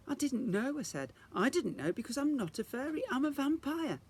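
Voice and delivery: put-on high, upset girl's protest; a hint of crying girl defending herself; tearful Isadora. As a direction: blurt it out close to tears. Isadora near tears.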